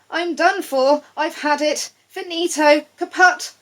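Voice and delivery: high, loud panicky wail; a frog sure he's doomed; hammed-up panic; frog in despair. A narrator's reading.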